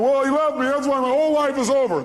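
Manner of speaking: high, agitated mock lament; upset jilted woman. Coach voices the caller who was left, upset and high. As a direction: imitate an angry, heartbroken woman. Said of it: "this woman's going".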